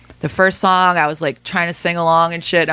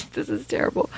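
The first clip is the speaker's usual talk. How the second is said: laughing it out under breath; 9 semitones higher, 8 dB quieter than usual. Real, not acted. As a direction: laugh through the words. Laughing at own blank mind.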